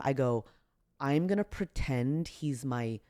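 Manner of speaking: ordinary talk, nothing marked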